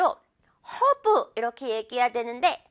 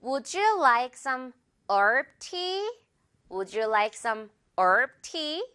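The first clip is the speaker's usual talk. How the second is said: very slow, herb with silent h, "얼브"; drawn out so learners can copy; slow model sentence; much slower than usual. Shown as a demonstration. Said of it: "herb"라고 한다는 거". (H 묵음으로 아주 천천히 읽기)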